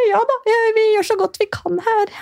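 a mock sugary reply; forced politeness. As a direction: swallow the hurt and sound pleasant. Strained polite reply to stranger.

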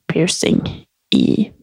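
whispered, shy; embarrassed whisper. Real, not acted. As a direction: drop to a whisper on the awkward word. Shy admission of nipple piercing. (flau hvisking)

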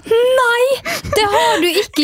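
shocked disbelief. Real, not acted. Listener shocked by story.

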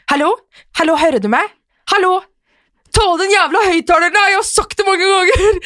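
high, loud, exasperated shouting down a bad line; frustrated yelling into phone. Partner shouting down a bad phone line, high and exasperated. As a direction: yell like you can't be heard and you're fed up. Acted phone quarrel with Dennis.

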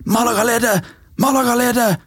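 shouting. Retold or imitated. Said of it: "sto Lotina og ropte".

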